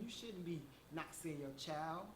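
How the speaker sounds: usual speaking voice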